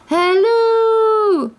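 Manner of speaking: high, long-held holler; loud, drawn-out calling; shouted call. A narrator's reading. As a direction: shout it out with a stretched final note. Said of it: "he shouted to the right".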